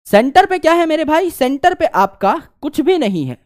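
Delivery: high, loud quiz to the class; excited question. Real, not acted. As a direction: fire it at the students with energy. Teacher asks class rhetorically.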